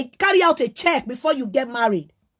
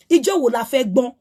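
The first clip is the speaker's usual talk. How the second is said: normal talking voice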